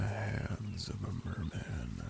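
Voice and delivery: unvoiced, sly, close in; teasing whisper. Merman teasing the ruler about his nerves, whispered. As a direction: breathe it as a playful tease.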